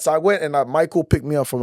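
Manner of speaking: ordinary talk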